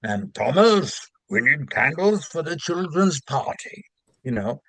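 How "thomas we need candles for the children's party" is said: high, bright cartoon engine voice; Thomas the Tank character voice. Shown as a demonstration. Narrator recalls voicing Thomas the Tank, high cartoon voice. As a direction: pop up into a chirpy storybook engine voice. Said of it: "I did all the voices".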